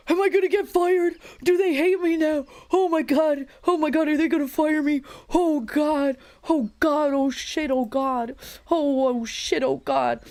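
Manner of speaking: high, rapid-fire hammed-up worry; mock panic. Acting out panic over low viewers.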